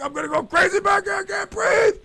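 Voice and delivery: loud, high, panicked shouting; threatening the cop from the back seat, shouting; frantic yelling. Retold or imitated. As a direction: yell like he's about to transform. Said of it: "I'm like".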